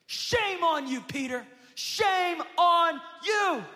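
loud, high shaming shout at Peter; accusing shout. Acted. Rooster shouting shame at Peter, loud accusing shout.

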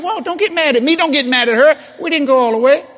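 high-pitched, hammed-up protest of innocence; mock placating. Acting out calming an angry husband.

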